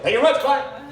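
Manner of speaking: high, mildly loud mock farewell; mock sign-off. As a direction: imitate a comic wrapping up the show too soon. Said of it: "I realized that sounded like".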